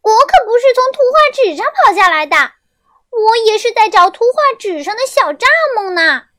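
squeaky bug shouting back; loud grasshopper. A narrator's reading. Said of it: "小蚱蜢大声地说". (小蚱蜢大声又尖地反驳)

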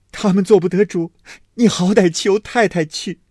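high, loud, sobbing plea; cling and beg through tears; crying plea. A narrator's reading. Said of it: "因拉住哭道". (哭着高声哀求)

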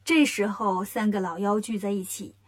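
ordinary delivery

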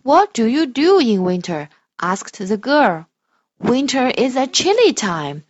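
loud, slow storybook voice; reading to children. Shown as a demonstration. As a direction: read it slowly and grandly for a child. Parent models expressive reading.

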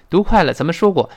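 usual speaking voice

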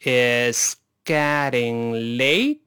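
非常慢地一词一顿，late拖长上扬; 带学生慢速跟读，每个音拉长; 慢速领读. Shown as a demonstration. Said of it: "先用慢速来一遍". (极慢示范，句尾挑起)

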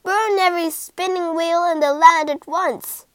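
loud royal bellow; bellowing king. Acted. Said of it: "bellowed the king".

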